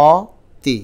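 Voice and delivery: অতি with an open 'aw' first vowel, said loud and apart; spelling pronunciation. Shown as a demonstration. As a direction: sound out অতি letter by letter as 'aw-ti'. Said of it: "লেখা আছে কি দেখো".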